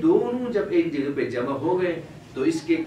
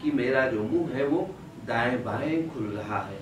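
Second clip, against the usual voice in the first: ordinary talk, nothing marked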